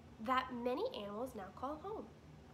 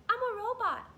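much higher, louder robot declaration; robot Roz; 12 semitones higher, 11 dB louder than usual. Acted. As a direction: announce it proudly as the robot.

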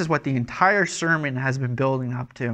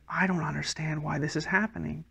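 soft, mildly bewildered aside; understated acted confusion; 7 dB quieter than usual. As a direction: quietly voice a private puzzlement. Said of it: "it's just like".

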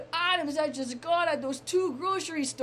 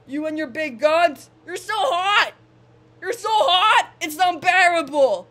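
belted-out plea to an idol; loud fan proposal; 8 dB louder than usual. Pretend. Fan proposing to [name], loudly smitten. Mock proposal to celebrity crush.

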